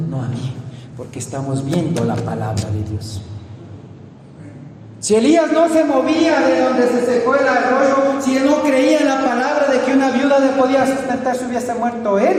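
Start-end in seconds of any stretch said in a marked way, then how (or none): 5.0-12.4 high, loud preacher's proclamation; raised, emphatic sermon delivery; forceful preaching. Acted.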